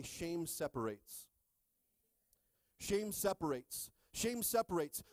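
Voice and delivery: "Shame separates" slow, leaning on "Shame"; slow declaration. Shown as a demonstration. Pastor having the church repeat it, slow and weighty. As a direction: pound "Shame" home slowly. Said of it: "Somebody say".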